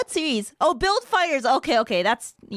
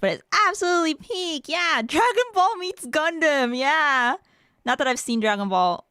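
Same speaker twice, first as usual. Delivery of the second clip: ordinary delivery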